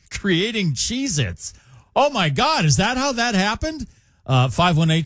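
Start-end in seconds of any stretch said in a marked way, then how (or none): 0.1-1.5 laughing. Real, not acted. Laughing at a listener's joke text. 2.0-3.9 high-pitched "oh my God" gasp; mock amazement. Mock amazement at a joke text.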